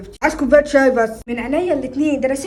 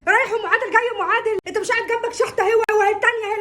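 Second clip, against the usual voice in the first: high-pitched, worked-up complaint; heated quarrelling; 5 semitones higher than usual. Acted. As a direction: throw it back in a raised pitch. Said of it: "ما تعليش صوتك".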